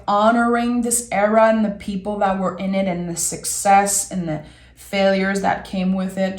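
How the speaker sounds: ordinary delivery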